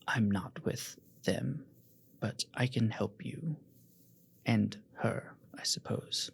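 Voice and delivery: whispered aside, slow and hushed. Acted. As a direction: breathe it secretly to the party. Milo murmurs to players.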